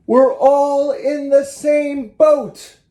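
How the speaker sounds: high, loud and slow, each word drawn out; Noah hollering. Acted. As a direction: bellow it to silence the whole ark. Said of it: "and hollered".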